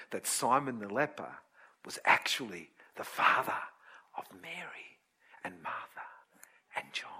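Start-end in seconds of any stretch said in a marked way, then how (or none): 1.8-7.1 dramatic whisper. Acted. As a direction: drop to a breathy secret whisper, much lower. Dramatic reveal to congregation.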